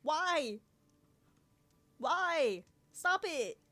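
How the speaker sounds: loud, high alarmed cries; startled shout. Real, not acted. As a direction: shriek in playful fright. Startled by creepy NPC voice.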